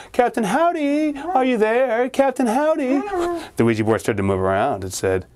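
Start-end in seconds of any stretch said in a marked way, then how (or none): 0.1-3.0 high, slow calling into the dark. Acted. Danny calling out to the ghost, high and slow. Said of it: "He started calling out".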